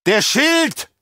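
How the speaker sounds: loud alarmed shout. Acted. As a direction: yell a warning across the hall. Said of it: "schrie einer der Sardaukar-Offiziere".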